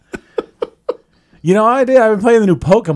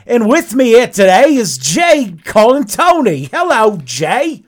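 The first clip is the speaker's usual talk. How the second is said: hammed-up bloke's British accent; the show host greets his guests in a put-on British accent; mock British accent; 4 semitones higher, 3 dB louder, slower than usual. Show host welcomes guests in a hammed-up British accent. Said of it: "I'm not good at accents".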